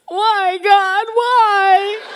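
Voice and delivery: high, loud, anguished wail to God; retold sobbing plea, loud and high; wailing cry. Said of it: "I am crying so hard".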